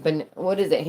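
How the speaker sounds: ordinary talk, nothing marked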